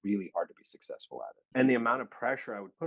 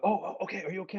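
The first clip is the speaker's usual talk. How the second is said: higher, flustered concern; worried dad; 11 semitones higher, much faster than usual. Retold or imitated. Dad hearing of an accident, flustered. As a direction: sound startled and anxious for your son.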